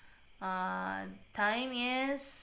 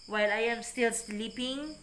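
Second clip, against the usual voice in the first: usual speaking voice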